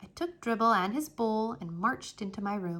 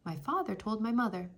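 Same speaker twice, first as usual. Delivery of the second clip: plain reading voice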